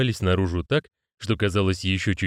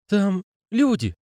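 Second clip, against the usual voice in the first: unsure; 12 semitones higher, slower than usual. A narrator's reading. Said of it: "неуверенно улыбаясь".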